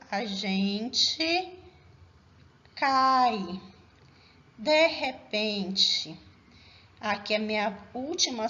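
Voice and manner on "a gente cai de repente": slow syllable-by-syllable reading with long gaps; scanning the verse. Shown as a demonstration. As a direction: stretch each poetic syllable apart for counting. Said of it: "vamos ver se tem cinco, sete sílabas".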